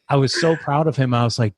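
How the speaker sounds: ordinary talk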